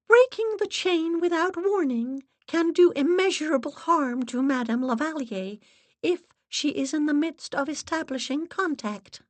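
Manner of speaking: high-pitched, imperious schoolmistress; stern headmistress. A narrator's reading. Assistant instructing the séance sitters, stern headmistress tone. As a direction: lecture them like a disapproving headmistress. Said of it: "in a tone that reminded me of the headmistress".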